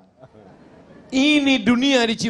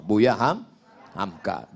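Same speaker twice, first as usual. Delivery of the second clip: usual speaking voice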